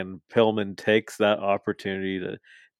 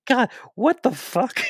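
much higher, squeaky disbelief at the line; shocked reaction. Retold or imitated. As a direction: act out being stunned by what he said. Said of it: "I was like".

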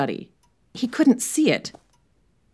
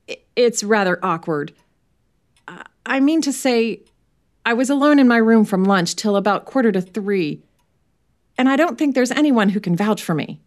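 put-on higher, uneasy young widower; nervous Merton; 5 semitones higher, 3 dB louder than usual. Merton explaining his alibi to the inspector, nervously. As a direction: fumble through an awkward alibi.

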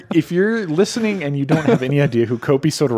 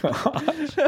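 ordinary talk, nothing marked

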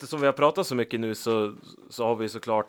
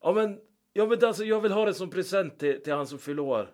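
lightly voiced pleading fan, pitched higher; a hint of a pushy guest explaining; imitating the fan; 7 semitones higher than usual. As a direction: plead for the birthday present, pitched up. Retold fan insisting on request.

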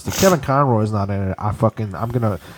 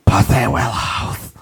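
creepy voice; 4 semitones lower, slower than usual. Pretend. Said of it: "It's like a creepy transvestite".

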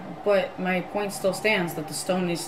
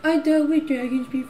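higher, teasing repeat; mocking echo; 7 semitones higher than usual. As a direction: parrot it back mockingly. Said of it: "player mimics the line".

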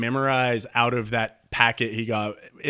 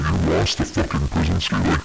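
low, crackly caller getting annoyed; annoyed client on a bad line; mad phone voice; 7 semitones lower than usual. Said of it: "he just starts getting mad".

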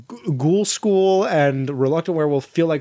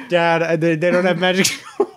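cracking up while pleading to dad; giggling, can barely get the words out; laughing through it; 4 semitones higher than usual. Retold or imitated. Kid telling dad, breaking up.

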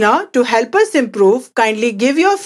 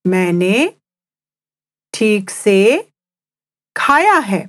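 मैंने, pause, ठीक से, pause, खाया है; three chunks with pauses between; slow teaching read in three parts; slowly; faster than usual. Shown as a demonstration. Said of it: "I'll say it again slowly".

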